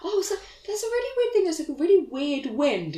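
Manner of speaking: high, quick, whimpering retelling; put-on receptionist. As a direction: squeak it out hesitantly. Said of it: "And it's like".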